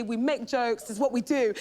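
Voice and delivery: ordinary delivery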